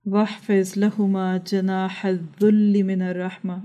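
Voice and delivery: measured, devout Arabic chanting of the verse; Quranic recitation. Acted. Preacher reciting the verse on humility to parents, reverent.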